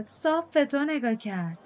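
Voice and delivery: high, drawn-out exclamation; excited shout. A narrator's reading. Mikhael slapping Mal's back, excitedly exclaiming. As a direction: crow it slowly, pitched well up, without real volume. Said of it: "فریاد زد".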